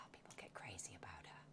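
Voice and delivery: whispered aside, no voice at all. Real, not acted. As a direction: lean in and whisper it like a secret. Confiding aside about fans.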